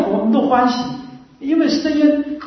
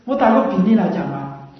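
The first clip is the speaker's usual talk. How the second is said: ordinary talk, nothing marked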